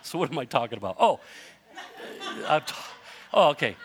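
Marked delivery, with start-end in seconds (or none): none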